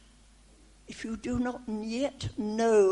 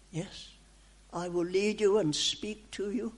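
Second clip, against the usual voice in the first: ordinary talk, nothing marked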